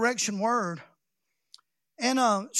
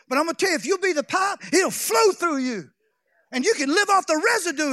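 higher, louder preaching climax; preaching at full force; 6 semitones higher, 5 dB louder than usual. Real, not acted. Preacher exhorting congregation to be the pipe, shouting. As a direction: build it up and shout it out.

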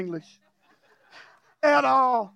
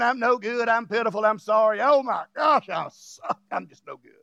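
low, fading self-pitying mumble; mock self-pity; 4 semitones lower than usual. Preacher mocks a deceived believer, sinking to a low mumble.

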